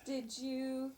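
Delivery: normal talking voice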